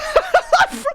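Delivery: loud, high helpless laugh; high burst of laughter. Real, not acted. Remembering the Christmas spawn, bursting out laughing. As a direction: crack up completely.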